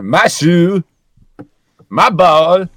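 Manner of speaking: put-on loud Borat-style accent. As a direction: bark it like Borat. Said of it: "imagining Borat going bowling".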